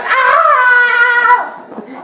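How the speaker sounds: a high falsetto scream of labour; high falsetto shriek. Retold or imitated. Mother screaming in labour in a high shriek. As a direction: shriek up high as she gives birth.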